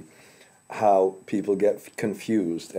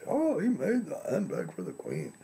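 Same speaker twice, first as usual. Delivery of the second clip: mock boastful, lifted high; bragging; 8 semitones higher, 8 dB quieter than usual. Lecturer bragging to people about his friend, boastful. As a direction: show off a friend's royal handbag. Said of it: "I kind of brag and say".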